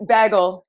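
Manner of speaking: "bagel" slow and loud; demonstrated pronunciation of bagel. Shown as a demonstration. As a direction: present "bagel" as a test word. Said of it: "a word that you say different".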